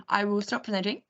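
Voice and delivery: ordinary talk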